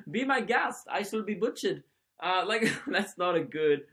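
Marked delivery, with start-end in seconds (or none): 0.1-1.8 high-pitched mock German accent; bad German accent. German volunteer inviting cannibals to dinner, bad German accent. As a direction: offer it up in a clumsy, hammy German accent. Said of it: "not a good German accent".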